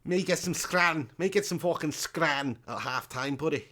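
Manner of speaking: hammed-up Liverpool accent; jokey Scouser with thick vowels; Scouse accent. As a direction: put on a broad Scouse voice. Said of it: "Do a Scouser accent".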